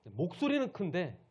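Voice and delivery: slow, high, loud emphasis. Shown as a demonstration. Preacher contrasts big voice with false life, loud voice.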